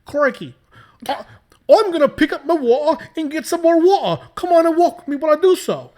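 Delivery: high, loud hammed-up Australian accent; Crikey, play the Aussie big and loud. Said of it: "Help me do an Australian accent".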